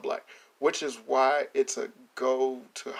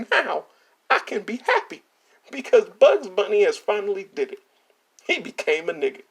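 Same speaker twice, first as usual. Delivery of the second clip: mock joyful fan; 4 semitones higher, 4 dB louder than usual. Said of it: "They go".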